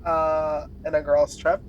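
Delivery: normal talking voice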